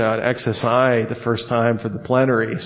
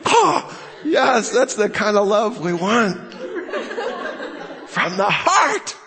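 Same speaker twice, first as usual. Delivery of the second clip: gushing mock delight; 9 semitones higher, slower than usual. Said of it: "I'm like".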